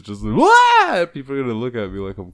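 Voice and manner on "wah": loud, high-pitched yelped "bwah"; silly "wah" noise. Shown as a demonstration. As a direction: burst out with a stretched "wah". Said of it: "I'm gonna be doing that at work".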